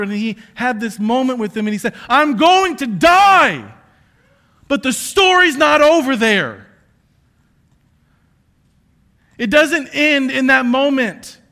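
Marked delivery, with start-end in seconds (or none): 2.1-11.4 loud, high, slow proclamation; preaching cry; slow preaching shout. Acted. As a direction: proclaim it slowly at full force. Jesus at the Supper, preached.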